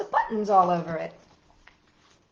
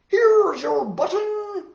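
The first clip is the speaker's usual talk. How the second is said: high excited cry, drawn out slowly; cried out; 10 semitones higher, 9 dB louder, much slower than usual. Acted. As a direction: call it out high and loud, stretching it. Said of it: "cried Frog".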